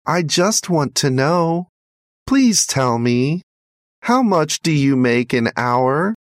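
put-on high child's voice, a mild plea; pleading boy. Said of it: "pleaded the little boy".

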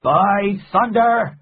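loud, high, drawn-out oath; crying out. A narrator's reading. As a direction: cry it out slow and high. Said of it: "he cried".

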